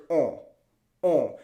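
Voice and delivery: "on" with lips rounded tight, sent through the nose; nasal French on. Shown as a demonstration. Teacher showing learners the French "on", tight-lipped and nasal. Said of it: "lips nice and tight".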